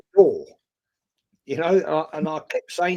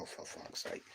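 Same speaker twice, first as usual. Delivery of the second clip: whispered thought; 25 dB quieter than usual. Retold or imitated.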